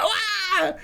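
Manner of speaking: high thrilled wah with a laugh; excited wah. Retold or imitated. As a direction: squeal of delight at finding the song. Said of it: "And I'd be like".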